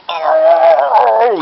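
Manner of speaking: hammed-up loud, high "oh"; overacted groan of pain. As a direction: howl it out, overacting wildly. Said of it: "It's just really overacting".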